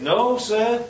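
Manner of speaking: respectful denial. Acted. Prisoner answering the Roman official, respectful but emphatic. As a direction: correct him firmly but deferentially. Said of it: "he treats him with respect".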